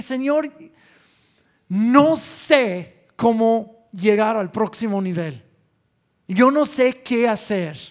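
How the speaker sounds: loud pleading prayer. Retold or imitated. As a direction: beg the Lord out loud, drawn out. Retold desperate prayer to God.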